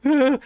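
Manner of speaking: high, short cry of horror; horrified gasp. Acted. Diplomat being patted down roughly, high horrified cry.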